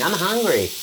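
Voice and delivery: put-on high, whiny "I'm hungry" like a hungry child; whiny kid voice. Dad mimicking his hungry son at the table, high whiny. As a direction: imitate the complaining kid. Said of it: "they're complaining".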